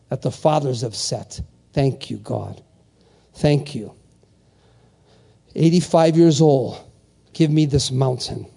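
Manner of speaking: low, measured prayer voice; closing prayer. Real, not acted. Preacher thanking the Father, low closing prayer. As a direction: pray it low, with long pauses.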